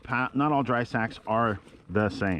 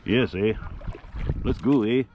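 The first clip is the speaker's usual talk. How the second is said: put-on jokey Canadian accent with eh; teasing the geese in a mock Canadian accent; 3 semitones higher than usual. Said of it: "I know they don't really talk like that".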